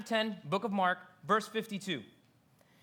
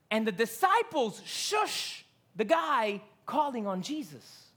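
ordinary delivery

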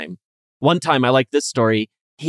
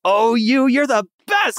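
much higher, squealy fawning; high fawning emperor; 11 semitones higher than usual. Retold or imitated. Emperor fawning over flattering Lu Shan, high mock squeal. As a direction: squeal it like an adoring fan. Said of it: "Wen Song was just like".

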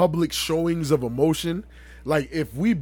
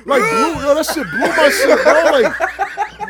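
loud, laughing through the words; 7 semitones higher, 7 dB louder, much slower than usual. Real, not acted. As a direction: crack up while telling it. Laughing while retelling.

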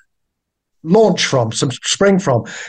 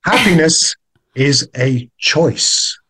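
careful repetition; 2 semitones lower, much slower than usual. Real, not acted. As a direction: repeat it word by word, very deliberately. What did you just say?.

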